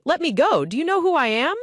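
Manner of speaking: high indignant yell; child yells back. A narrator's reading. Captured boy yelling back at the guard, high defiant yell. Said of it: "a captive child yells back".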